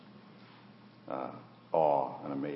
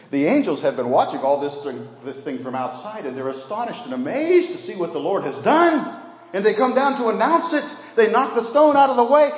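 much higher, louder fervent preaching; preaching at full force; 11 dB louder than usual. Acted. Preacher to congregation, fervent high preaching. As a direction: proclaim it with rising fervour.

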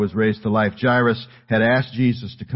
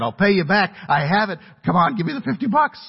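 high, loud, insistent begging; pleading teenager; 11 semitones higher, 3 dB louder than usual. Retold or imitated. Son begging his mom for fifty bucks, pleading. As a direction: wheedle like an overeager kid. Teen begging mother for money.

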